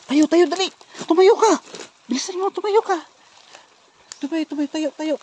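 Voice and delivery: high-pitched insistent urging; urgent hurrying. Acted. Rescuer urging the woman to stand quickly, urgent. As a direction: hurry someone onto their feet.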